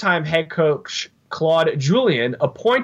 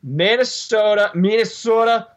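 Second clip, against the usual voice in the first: higher, hammed-up Minnesota accent; 4 semitones higher than usual. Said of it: "I can do them all in accents".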